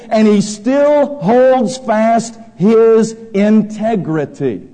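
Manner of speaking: loud, high sermon voice, pressing each phrase; full-force preaching. Acted. Preacher to congregation, loud fervent preaching. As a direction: thunder it from the pulpit.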